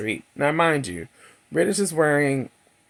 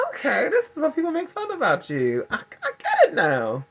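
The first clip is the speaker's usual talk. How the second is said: much higher, amused put-on voice; mock realization. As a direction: play it wryly, laughing at yourself. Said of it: "which I was also like".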